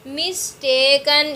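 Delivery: "Mistaken" slowly in three beats; syllable by syllable. Shown as a demonstration. Teacher counting three syllables, "mistaken" slowly segmented. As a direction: stretch out "मिस-टे-कन" for counting. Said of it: "इसमें तीन आ जाते हैं".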